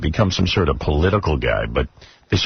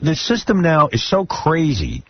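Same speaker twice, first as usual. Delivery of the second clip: exasperated; 7 semitones higher than usual. Real, not acted. Host venting about censors.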